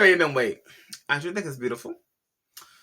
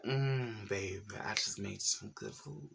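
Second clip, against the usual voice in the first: Tabitha Brown; 4 semitones lower, 13 dB quieter, slower than usual. Retold or imitated. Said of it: "she look like".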